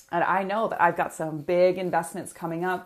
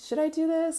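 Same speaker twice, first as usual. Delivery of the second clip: self-doubt; 10 semitones higher than usual. Retold or imitated. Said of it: "I was like".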